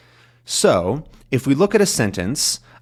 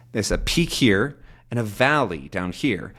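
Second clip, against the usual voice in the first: normal talking voice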